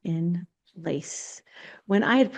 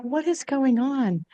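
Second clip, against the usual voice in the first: baffled; 6 semitones higher, slower than usual. Retold or imitated. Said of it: "And I'm like".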